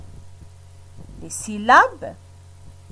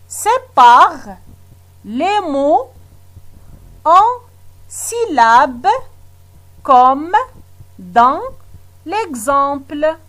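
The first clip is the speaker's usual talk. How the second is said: slow word-by-word reading with pauses; reading out instructions; 3 semitones higher, slower than usual. Shown as a demonstration. Teacher reads the exercise instruction to pupils, word by word. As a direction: read it like a dictation, pausing between words. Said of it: "Je lis la consigne".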